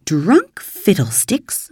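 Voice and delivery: a slow, drawn-out scoff; scornful dismissal. A narrator's reading. Marilla waves away the drunkenness charge with slow scorn. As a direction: brushes the accusation off as nonsense.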